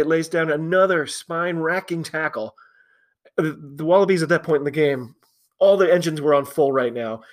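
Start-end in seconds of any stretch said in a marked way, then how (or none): none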